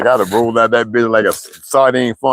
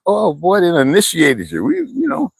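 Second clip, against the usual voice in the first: family teasing; 8 semitones higher than usual. Retold or imitated. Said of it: "they was like".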